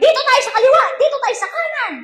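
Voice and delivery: high, bossy backseat voice; mock backseat directions. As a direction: pipe up like a pushy passenger. Driver's passengers giving directions.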